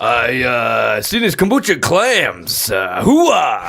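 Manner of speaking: loud gung-ho soldier. Pretend. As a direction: bark it like a fired-up soldier. Gung-ho outburst about clams.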